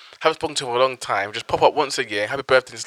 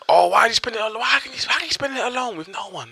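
mocking nosy people; 9 semitones higher, 4 dB quieter, faster than usual. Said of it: "If people think".

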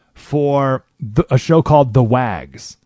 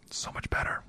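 soft unvoiced whisper; 18 dB quieter than usual. Real, not acted. Telling listeners to read a book, whispered. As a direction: lean in and whisper it. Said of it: "whispered aside".

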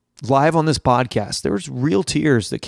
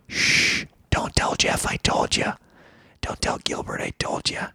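hushed, conspiratorial whisper; secretive whisper; 5 dB quieter than usual. Acted. Podcaster confiding Gilbert's secret to listeners, whispered. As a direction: shush them, then whisper the secret.

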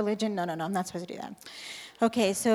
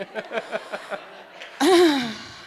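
laugh; 6 dB louder than usual. Real, not acted. Laughing off heavenly voice.

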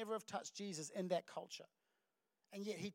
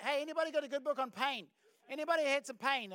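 loud, higher, comically keen asking round; mock eager request; 6 semitones higher, 6 dB louder than usual. Mock customer asking for a book on pain, comically eager. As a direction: call out keenly as if touting for pain.

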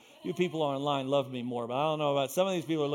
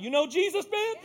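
high, loud holler to a passer-by; playfully yelled street witnessing; calling out; 12 semitones higher, 6 dB louder than usual. Acted. Driver calling out the window about Jesus, hollering.

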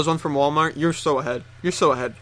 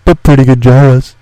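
lower, much louder put-on voice; lower loud echo; 4 semitones lower, 15 dB louder than usual. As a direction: echo it in a booming voice. Echoes tagline in lower voice.

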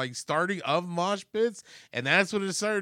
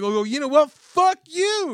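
much higher, louder retold cursing; mock outraged comeback; 12 semitones higher, 6 dB louder than usual. Shopper swearing back at old lady, loud mock outrage. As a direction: fire back loudly, voice shooting way up.

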